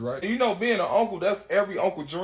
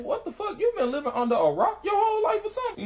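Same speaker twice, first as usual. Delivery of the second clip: much higher, laughing disbelief; incredulous. Retold or imitated. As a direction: squeal it in shocked amazement. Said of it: "I said".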